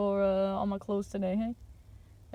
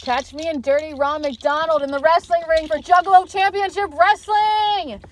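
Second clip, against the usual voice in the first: loud, higher ring-announcer build to a shouted final word; wrestling promo hype; 6 semitones higher, 10 dB louder than usual. Acted. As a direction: sell the match like an announcer yelling to the crowd. Promoting his wrestling match, hype.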